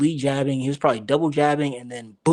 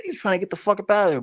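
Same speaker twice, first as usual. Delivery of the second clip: excited retelling; 7 semitones higher, much faster than usual. Retold or imitated. Retelling fighter fleeing.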